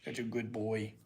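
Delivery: tender low dog talk; cooing to dog. Real, not acted.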